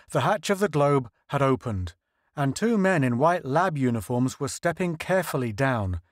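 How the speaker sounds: ordinary delivery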